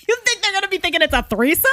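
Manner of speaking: high, fast, loud disbelieving yelp; incredulous shout. Real, not acted. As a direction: shriek it out in amused disbelief. Incredulous at cohost's claim.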